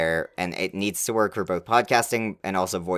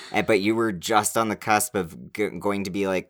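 usual speaking voice

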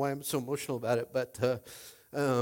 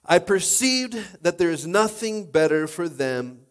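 louder, higher preaching voice; 4 semitones higher, 9 dB louder than usual. Acted. Pastor urging the congregation to ask God for help, preaching loudly. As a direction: preach it out with full force.

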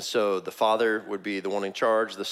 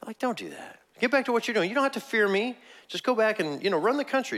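much higher, incredulous pleading; David's exasperated rebuke; 11 semitones higher than usual. Retold or imitated. David to the king hunting him, high exasperated rebuke. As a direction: scold the king in a high, baffled voice. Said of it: "So David's just like".

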